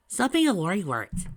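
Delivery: put-on high, loud kid voice; kid sidekick scolding. A narrator's reading. Tails tells big brother to relax, chiding.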